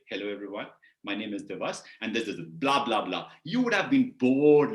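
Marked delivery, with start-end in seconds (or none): 0.1-3.3 low, subdued formal self-introduction; stiff, boring introduction. Acted. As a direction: recite it like a dull, straight-sitting presenter. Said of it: "straight, and say".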